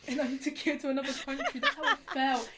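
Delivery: amused, loud laughing delivery. Real, not acted. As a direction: laugh helplessly as you tell it. Laughs while retelling the crossing.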